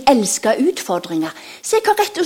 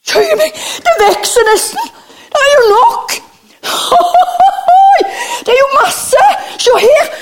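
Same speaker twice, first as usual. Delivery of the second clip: much higher, loud, laughing astonishment; amazed disciple; 13 semitones higher, 7 dB louder than usual. Acted. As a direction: marvel as the basket keeps filling. Disciples amazed food grows.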